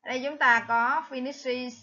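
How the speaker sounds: ordinary talk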